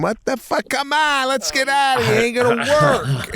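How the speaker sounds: bitching. Retold or imitated. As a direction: grumble to get out of there. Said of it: "I'm bitching up a storm".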